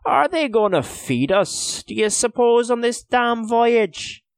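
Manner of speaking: slow, high, loud grumble; complaining. A narrator's reading. Owen grumbles about food on the voyage, loud complaint. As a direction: demand food with rising indignation. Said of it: "complained Owen suddenly".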